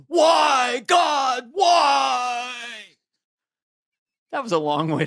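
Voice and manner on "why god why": high, anguished drawn-out wail; Nicolas Cage. Acted. Actor backstage venting for the staff, overwrought shout. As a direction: cry out to heaven, hammed up. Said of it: "drops to his knees and goes".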